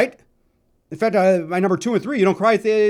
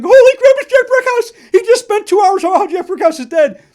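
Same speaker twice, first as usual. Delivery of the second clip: much higher and louder, laughing in disbelief; astonished; 13 dB louder than usual. Retold or imitated. Two friends stunned at the stage door, shrieking in disbelief. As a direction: squeal it out, stunned.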